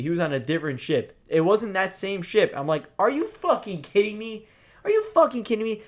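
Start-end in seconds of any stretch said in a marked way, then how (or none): 3.0-5.8 high-pitched indignant protest; exasperated disbelief. Retold or imitated. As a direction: repeat it in incredulous outrage. Acting out own outraged reaction.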